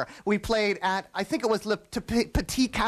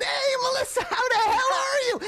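excited shout. Retold or imitated. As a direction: yell it out with delight. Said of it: "I said".

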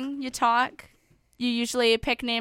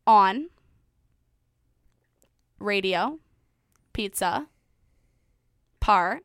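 syllable by syllable; 2 semitones lower, slower than usual. Shown as a demonstration. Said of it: "I choose to end it there".

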